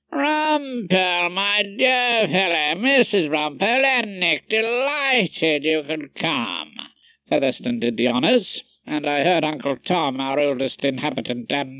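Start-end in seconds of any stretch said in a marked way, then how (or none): 0.1-6.7 slow, drawn-out delighted greeting, starting high; effusive welcome. A narrator's reading. Host greeting Rumpole and his family, effusive. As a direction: savour each word of welcome.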